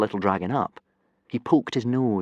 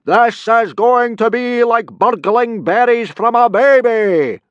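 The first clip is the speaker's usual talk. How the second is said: loud, slow, higher gloating boom; 11 dB louder, slower than usual. Acted. Teacher booming a boast at the boys. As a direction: boom it, sure of easy victory. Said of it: "boomed Gobber the Belch".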